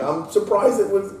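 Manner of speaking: high, loud surprised start; mock surprise. Dad reacting to his son, high and surprised. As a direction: cut off mid-thought. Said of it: "I'm like".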